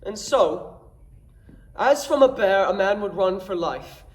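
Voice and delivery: horrified comic complaint, punching the key words; comically aghast Dromio. Acted. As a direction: play to the house with big swoops and pauses for each joke. Performs Dromio's comic Shakespeare monologue.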